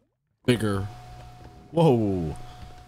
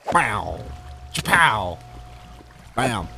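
fight sound effects; much slower than usual. Acted.

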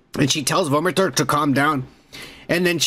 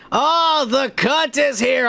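hype shout; 8 semitones higher, slower than usual. Real, not acted. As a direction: call out the big arrival with excitement. Hyping up a viewer's arrival.

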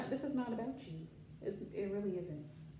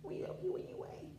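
soft high whisper; 9 semitones higher, 7 dB quieter than usual. Real, not acted. Murmuring to her bunny, soft high whisper.

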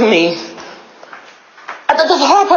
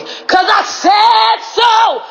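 high, drawn-out declaration; yelling; 8 semitones higher, much slower than usual. Real, not acted. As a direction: yell it slowly, every word stretched. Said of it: "me yelling".

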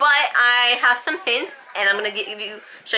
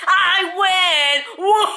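gloating; 9 semitones higher, 3 dB louder, much slower than usual. Real, not acted. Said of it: "teasing husband".

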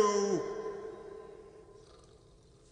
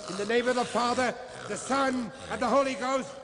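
louder, higher, faster command to cast out; commanding exorcism; 6 semitones higher, 4 dB louder than usual. Acted. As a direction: drive the devil out with full force. Priest exorcises Satan.

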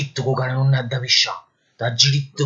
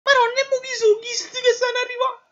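Neapolitan clown voice; 4 dB quieter than usual. Acted. Detainee whimpering, other officers arrive.

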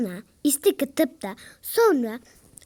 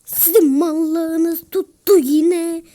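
slow scolding; 2 semitones higher, 8 dB louder, much slower than usual. Real, not acted. Scolding teammates.

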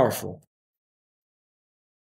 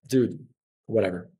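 soft, unimpressed, much quieter; dismissive; 6 semitones lower, 6 dB quieter, faster than usual. Retold or imitated. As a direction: shrug it off like a bored student. Dismissive inner reply to teacher.

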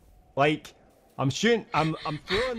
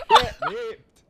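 much higher, louder laughing taunt; gleeful taunt; 4 dB louder, slower than usual. Pretend. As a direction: crow over the kill.

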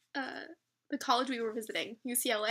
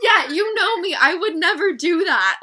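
much louder, laughing, playful; loud giddy teasing; 5 semitones higher, 16 dB louder than usual. Real, not acted. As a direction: tease her loudly through laughter.

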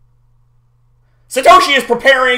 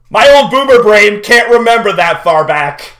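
laughing. Real, not acted. Laughing at himself.

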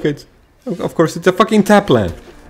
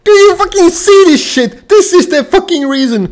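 outraged shout; 8 semitones higher than usual. Real, not acted. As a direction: yell it at the screen in disbelief. Outraged at late land draw.

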